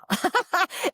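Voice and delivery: high, loud burst of laughter; delighted laugh. Retold or imitated. Realizing the good news, high delighted laugh. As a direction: laugh as it dawns on you.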